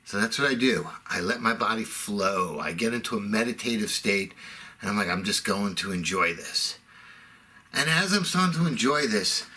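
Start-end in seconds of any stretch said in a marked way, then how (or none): 1.1-6.8 low, soft zen murmur; serene, low mock-zen tone; meditative calm. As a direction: let it flow out low and soothing. Acting out a meditative state.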